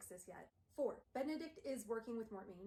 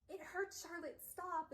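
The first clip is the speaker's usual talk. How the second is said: pained plea; 8 semitones higher, slower than usual. Retold or imitated. Pained under truth sword.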